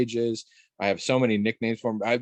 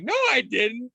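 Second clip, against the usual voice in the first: put-on kid voice; 12 semitones higher than usual. Child denying the fingers story.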